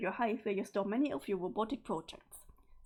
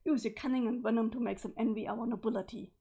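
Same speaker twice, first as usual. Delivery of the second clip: ordinary delivery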